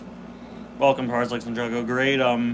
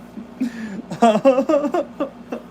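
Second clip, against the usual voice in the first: high laugh; 10 semitones higher than usual. Real, not acted. Laughing at own repeat.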